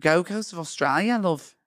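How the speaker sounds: put-on amateur Australian tourist accent. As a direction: put on a loose Aussie accent. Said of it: "we're Australian tourists".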